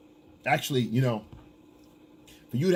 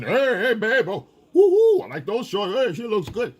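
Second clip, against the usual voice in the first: whooping construction-worker holler; catcalling yell; 8 semitones higher, 6 dB louder than usual. Retold or imitated. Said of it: "guys yelling with the construction workers".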